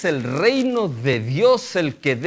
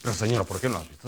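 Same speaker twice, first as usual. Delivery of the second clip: lower, grumbling complaint to the Lord, a bit rushed; a hint of a cranky dwarf grumbling at God; grumpy complaining; 9 semitones lower, 4 dB quieter, faster than usual. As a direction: whine like a grumpy smurf. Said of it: "del pitufo gruñón o el enano cascarrabias".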